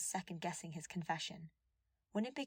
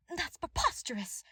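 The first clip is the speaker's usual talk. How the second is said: high, louder indignant shout; 11 semitones higher, 5 dB louder than usual. A narrator's reading. Cornelia rejecting the evidence, indignant shout. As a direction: fling it back in outrage. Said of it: "Cornelia shouted".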